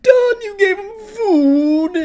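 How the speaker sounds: very high, loud mock crying; hammed-up tearful tantrum; mock meltdown wail. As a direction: drag it out into a hysterical wail. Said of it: "he starts blaming the pizza place".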